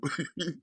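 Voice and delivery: short, high chuckle; light, quick laugh; amused chuckle. Real, not acted. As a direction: chuckle at your own bit. Amused by own impression.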